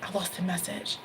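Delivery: hushed whisper to herself; a muttered whispered curse; whispered aside. Real, not acted. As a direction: whisper it under your breath, annoyed. Muttering to herself mid-reading.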